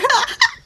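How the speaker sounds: sudden high squealing laugh; high burst of laughter. Real, not acted. Mom laughing at her own party story, high squealing laugh.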